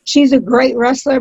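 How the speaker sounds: normal talking voice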